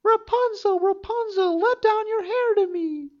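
high witch voice, calling up the tower; enchantress calling. Acted. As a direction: call up to the window like an old enchantress. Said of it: "she placed herself beneath the tower and cried".

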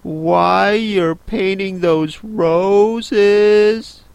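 timid. Acted. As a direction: ask shyly, half afraid. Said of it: "said Alice a little timidly".

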